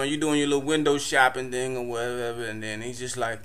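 ordinary talk

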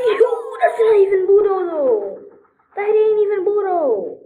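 high, loud cry of disgust; real grossed-out outburst; disgusted shout. Real, not acted. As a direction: shriek it out in disgust.

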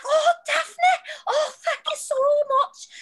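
put-on very high, gushing accented voice; Nikki Storm impression. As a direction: gush gratefully, hammed-up and squeaky. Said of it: "she's like".

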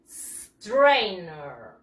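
"Strainer" stretched long and low, with a held s; model pronunciation. Shown as a demonstration. Teacher drills pupils on the word, model pronunciation. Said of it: "practice again the pronunciation".

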